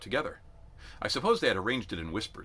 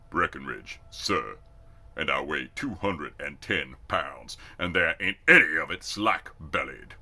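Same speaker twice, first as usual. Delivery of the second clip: lower, slow confident voice; big country recruit; 3 semitones lower, 4 dB louder than usual. A narrator's reading. Recruit boasts of his weight to the sergeant, low and slow. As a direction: lay it down slow and unafraid.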